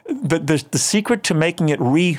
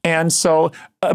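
ordinary talk

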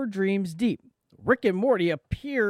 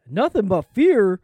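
put-on Canadian accent; 8 dB louder than usual. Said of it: "My Canadian voice".

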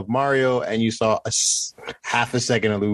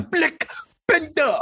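mock Forest Whitaker accent. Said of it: "his accent in that movie was completely wrong".

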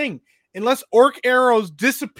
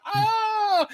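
mock scream; 11 semitones higher than usual. Retold mom screaming in woods.

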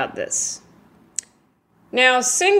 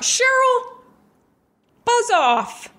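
dismissive snap; slower than usual. Acted. Snapping back at friend.

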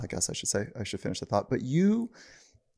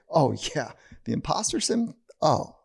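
dismissive; 5 semitones higher than usual. Retold or imitated. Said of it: "amount of them say".

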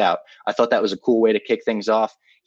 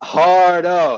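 mocking echo; 7 semitones higher, 8 dB louder than usual. Real, not acted. Echoing the mocking jeer.

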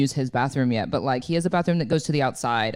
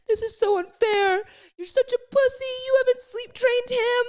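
much higher, whiny mock complaining voice; upset complaint. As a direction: replay the rant in a shrill-pitched mock whine. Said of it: "I was so upset".

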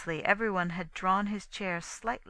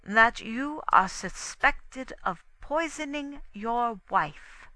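slow, higher, measured accusation; deliberate; 6 semitones higher, 4 dB louder, slower than usual. A narrator's reading. Poirot telling Inglethorpe he is suspected, deliberate. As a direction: spell out the charge slowly. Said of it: "said Poirot deliberately".

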